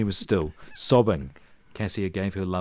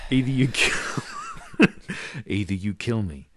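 breaking into laughter; 5 semitones higher, 4 dB louder than usual. Real, not acted.

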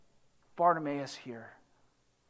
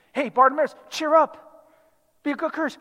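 higher-pitched, upbeat call; crowd encouraging; 4 semitones higher, 3 dB louder, faster than usual. Acted. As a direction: cheer someone on as one of the crowd. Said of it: "the people are like".

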